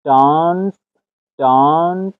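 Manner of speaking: "دانس" mit langem, dunklem britischem A, "daans"; British accent. Shown as a demonstration. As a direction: say "دانس" the British way, open and long. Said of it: "بریتیش".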